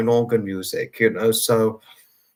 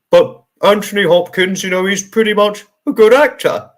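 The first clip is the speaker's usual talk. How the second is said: a light Chris Greener voice, higher and louder; lightly voiced Chris Greener, pitched up and loud; Chris Greener impression; 7 semitones higher, 6 dB louder than usual. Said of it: "That was a Chris Greener impression".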